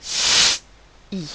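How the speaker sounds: S as a loud pure hiss, pause, then I; long hissed S without a vowel, then a short I; separate sounds. Shown as a demonstration. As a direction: split S and I into two isolated sounds. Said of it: "nous entendons bien deux sons".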